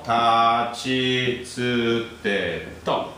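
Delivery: tachi tsu te to said slowly, syllable by syllable; slow recital. Shown as a demonstration. Teacher drilling the row for students, slow and deliberate. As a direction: spell out tachi tsu te to slowly so the class catches tsu. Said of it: "remember tsu".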